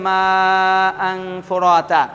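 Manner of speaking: মা held long, আং nasal into ফুরাতা; joined reading. Shown as a demonstration. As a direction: run আং straight on into ফুরাতা after a long মা. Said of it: "যদি মিলি পড়া হয় পরের শব্দকে".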